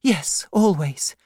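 put-on high, pleading young woman's voice; a frightened girl near tears; tearful young widow. The widow explains herself to the aunt, high and pleading.

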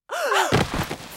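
sharp high gasp; frightened gasp. A narrator's reading. Boy grabbed by witches.

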